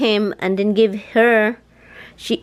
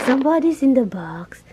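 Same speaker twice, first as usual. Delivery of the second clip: usual speaking voice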